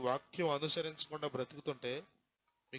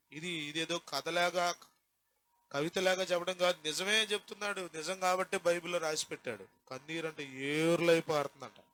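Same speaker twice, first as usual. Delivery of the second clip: ordinary talk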